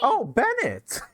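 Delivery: high mock cry. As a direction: whine it like a screaming kid. Mimics Bennett's cry for help.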